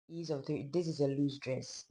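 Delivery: usual speaking voice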